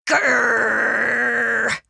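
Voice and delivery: long, loud rolled growl; drawn-out "grrr"; dog growl. Acted. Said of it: "growled Perky".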